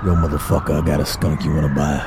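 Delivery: low, quiet dealer mutter; mock street dealer. Dealer offering a passing friend skunks, low and shady.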